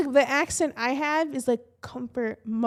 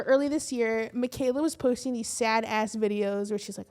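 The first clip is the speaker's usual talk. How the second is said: normal talking voice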